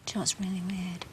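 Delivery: quick conspiratorial whisper. Real, not acted. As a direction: lean in and whisper it fast. Sitter confides in a hush.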